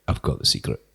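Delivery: a low, breathy whisper of a joke; secretive whisper. Retold or imitated. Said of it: "I'm like".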